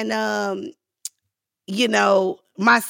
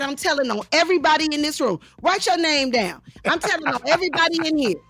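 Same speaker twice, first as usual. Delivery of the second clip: high, fast indignant rant; retold tantrum, pitched up; so mad; 8 semitones higher, faster than usual. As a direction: rattle off threats in a huff. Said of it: "I got so mad".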